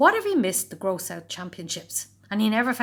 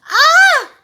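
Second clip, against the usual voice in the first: high, loud startled shriek; scream; 18 dB louder than usual. A narrator's reading. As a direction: scream in fright. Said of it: "screamed Henry".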